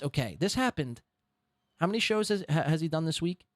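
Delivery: usual speaking voice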